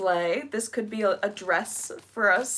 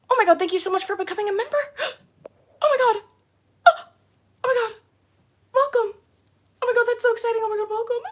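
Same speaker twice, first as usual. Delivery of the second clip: very high, loud excited gushing; excited welcome; 14 semitones higher, 5 dB louder, much faster than usual. Real, not acted. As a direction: gush with real delight at a new member. Thanking a new channel member.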